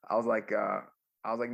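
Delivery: usual speaking voice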